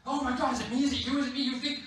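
high, hammed-up panic; freaking out. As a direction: play a flustered group all asking at once. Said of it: "they're all freaking out".

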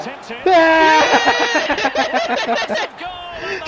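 shocked yell. Real, not acted. Player reacting to an Mbappé goal, shocked yell. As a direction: yell a long, high "eh!".

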